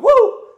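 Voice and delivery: sudden boo-style shriek from behind a corner; playful scare whoop. Retold or imitated. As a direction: leap out with a whoop to spook someone. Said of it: "she'd jump out and go".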